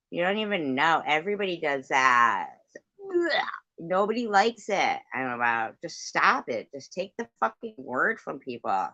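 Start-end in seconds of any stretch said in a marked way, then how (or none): 3.0-5.0 disgusted. Real, not acted. Disgusted outburst at topic.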